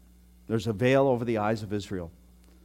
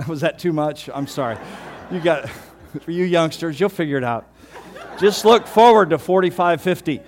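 laughing at his own joke, higher and louder; 6 semitones higher, 9 dB louder than usual. Real, not acted. Pastor after a prostate joke, laughing and louder. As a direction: giggle through the embarrassed apology. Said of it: "That's too much".

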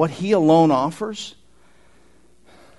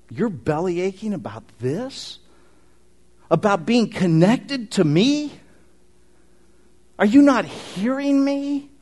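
pleading Jesus; 5 semitones higher than usual. Acted. Voicing Jesus pleading with disciples.

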